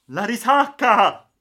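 high, drawn-out bellow of warning; thundering shout. A narrator's reading. Balboa spots the breakers from the deck, thundering. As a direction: roar out the danger. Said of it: "tuonò".

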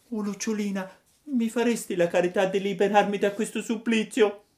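put-on high, puppet-boy voice, pleading; a wooden boy begging for help, pitched high; high child voice begging the firefly; Pinocchio pleading. A narrator's reading.

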